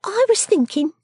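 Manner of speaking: high, quaint little voice, musing; pensive Miss Jenny. Acted. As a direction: muse aloud in a high character voice.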